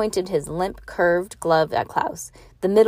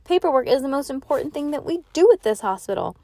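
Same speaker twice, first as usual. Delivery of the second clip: higher, emphatic declaration; mildly excited, raised pitch; crying out; 7 semitones higher than usual. A narrator's reading. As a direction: proclaim it with earnest conviction. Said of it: "Nurse cried".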